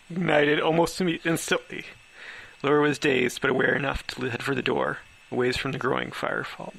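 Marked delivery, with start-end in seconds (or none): none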